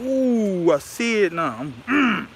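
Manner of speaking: high, loud startled ooh, then drawn out; surprised ooh. Real, not acted. Vlogger spotting the crack on his phone, high startled ooh. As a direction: caught off guard, voice jumping up.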